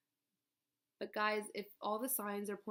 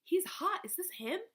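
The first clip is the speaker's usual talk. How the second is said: high, loud gush; excited gushing friend; 10 semitones higher, 4 dB louder than usual. Retold or imitated. Friend gushing over his photo, high and excited. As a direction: squeal it like she's impressed. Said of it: "She's like".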